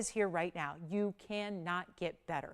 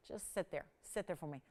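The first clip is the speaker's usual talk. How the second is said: murmured much softer, coaxing the ring; 4 semitones lower, 12 dB quieter than usual. Real, not acted. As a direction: coax it gently under your breath. Coaxing ring to sit still.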